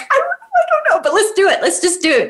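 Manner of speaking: mother answering her daughter with giddy daring; giddy excitement. Retold or imitated. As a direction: squeal it out, scared and thrilled at once. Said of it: "I'm like".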